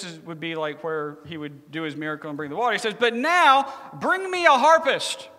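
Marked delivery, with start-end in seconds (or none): 3.0-5.3 high, loud, drawn-out command; demanding. Retold or imitated. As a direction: order it grandly, imitating the prophet. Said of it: "he makes a demand".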